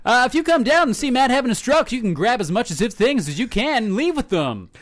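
radio promo hype. Pretend. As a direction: pitch it like a DJ running a contest. Mock radio promo patter.

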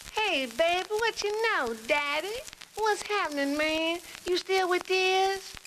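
very high, loose jazz-cat voice; hip jazz musician. Acted. Musician greeting a long-lost friend, hip jazz cat. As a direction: stretch the ending, high and hip. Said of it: "the conversation went like this".

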